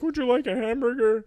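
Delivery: high put-on server voice; fast-food counter guy. Podcaster imitating a virtual Burger King worker, put-on role voice. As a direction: pitch it up like a chirping order-taker. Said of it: "guy behind the counter being like".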